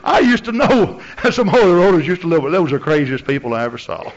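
talking through laughter, high and loud at first; hooting with laughter, settling as it goes; acted laughing scorn. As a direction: imitate someone cracking up at a memory. Acts out scoffer laughing about holy rollers.

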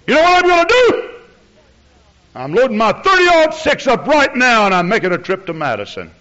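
loud, high, fast threatening rant; enraged outburst, easing toward the end; irate caller. Retold or imitated. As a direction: imitate a furious man yelling down the phone. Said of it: "He got so irate".

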